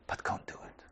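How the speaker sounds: breathy whisper on don't; whispered warning. Acted. Hushed warning in sermon.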